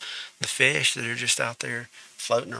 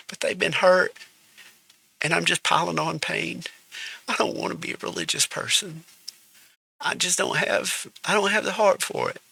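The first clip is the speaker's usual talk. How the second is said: fighting back tears while talking; real tearful, trembling plea; choked up; 5 semitones higher than usual. Real, not acted. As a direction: voice pitched high and breaking with tears. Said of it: "I got a little emotional".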